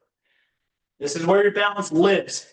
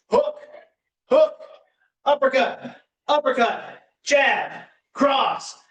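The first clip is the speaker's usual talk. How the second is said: higher, punchy call of each punch; boxing coach calling combos; 5 semitones higher than usual. Acted.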